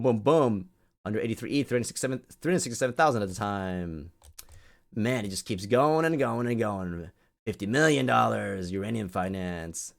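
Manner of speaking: ordinary talk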